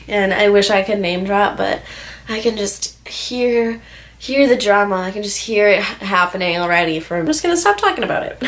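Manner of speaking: ordinary delivery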